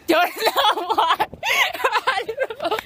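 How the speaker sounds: words broken by laughter, high; laughing. Real, not acted. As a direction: laugh through the words while hiding. Laughing while hiding.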